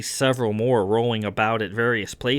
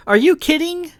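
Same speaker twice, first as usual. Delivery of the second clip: very high, loud incredulous question; excited disbelief; 6 dB louder than usual. Acted. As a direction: exclaim it in delighted disbelief. Character protests a friend's modesty.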